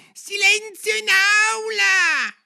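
very high, drawn-out crier's shout. A narrator's reading. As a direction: yell for order at full voice, stretched out. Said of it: "il coniglio bianco gridava".

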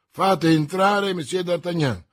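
commanding. A narrator's reading. Commissioner summoning a prisoner, commanding. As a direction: call out the command to the guards. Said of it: "ordinò il commissario".

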